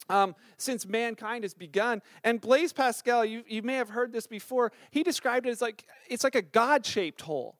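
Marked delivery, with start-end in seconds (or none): none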